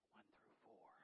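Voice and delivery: hushed whisper, barely voiced; whispered aside. Preacher telling his wife the verses, whispered. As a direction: whisper it so only your wife hears.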